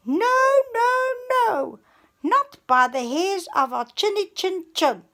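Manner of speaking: very high, loud squealed refusal; squealing pigs. A narrator's reading. Two pigs squealing back at the wolf, high and loud. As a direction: squeal it out in fright. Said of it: "squealed the little pigs".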